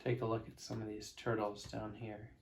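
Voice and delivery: hushed aside. Real, not acted. Reader stops to show the children the picture, hushed aside. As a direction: lean in and point out the picture. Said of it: "aside to the young listeners".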